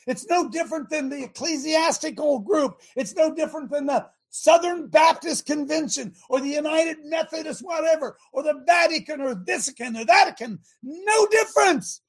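full-force preaching shout; fiery preaching. Acted. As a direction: preach it hard to the congregation.

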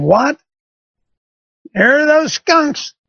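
high, slow incredulous echo of the words; disbelief. Retold or imitated. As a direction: repeat it back in stunned disbelief. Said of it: "I'm thinking".

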